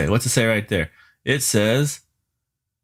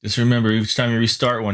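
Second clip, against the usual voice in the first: ordinary talk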